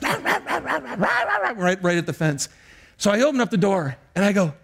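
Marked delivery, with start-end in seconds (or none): 0.0-1.8 high-pitched imitation of yapping; rapid mock dog barks; dog barking. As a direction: bark like an excited puppy. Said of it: "and just barking".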